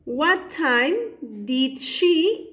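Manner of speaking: each word drawn out very slowly, What time did she; slow dictation. Shown as a demonstration. Teacher dictating to students while writing, very slow. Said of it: "el W H question".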